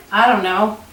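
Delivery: usual speaking voice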